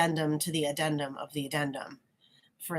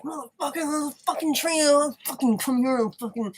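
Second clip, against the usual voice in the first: imitated rage; 8 semitones higher than usual. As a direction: act out him swearing at the missed train. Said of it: "That would trigger so much rage".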